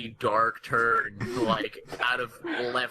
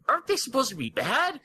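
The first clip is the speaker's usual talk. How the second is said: nervous question; 10 semitones higher, faster than usual. Acted. Chris asks nervously.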